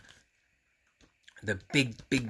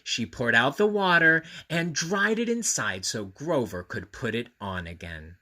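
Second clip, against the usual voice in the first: ordinary delivery, nothing marked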